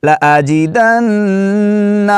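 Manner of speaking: slow high melodic tilawat; Quran recitation. Acted. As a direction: recite slowly with long drawn vowels.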